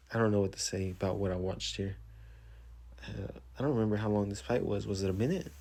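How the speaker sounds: ordinary delivery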